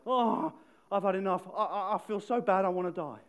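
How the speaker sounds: loud, high, melodramatic moaning; drama queen whine. Retold or imitated. Preacher mocks Jonah complaining to God, melodramatic whine. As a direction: ham up a self-pitying wail. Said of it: "a little bit of a drama queen".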